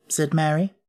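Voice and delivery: ordinary delivery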